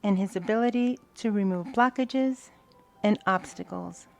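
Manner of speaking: prayer of thanks. Acted. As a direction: pray it out, one blessing at a time.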